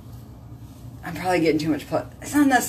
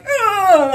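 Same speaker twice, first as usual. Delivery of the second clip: imagined dog yelp; 13 semitones higher, 5 dB louder than usual. Retold or imitated. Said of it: "picturing her like".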